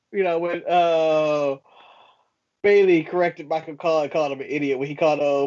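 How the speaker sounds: normal talking voice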